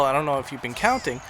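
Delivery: ordinary talk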